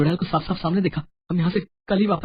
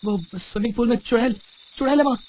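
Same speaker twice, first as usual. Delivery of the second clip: high, alarmed report; frightened; 7 semitones higher than usual. Acted. Husband tells his wife of the witch in the pool, frightened. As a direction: blurt it out in fright, pitch climbing.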